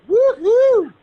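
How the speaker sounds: loud high woohoo; shrieking jackpot winner. Real, not acted. Magician liked the tweet.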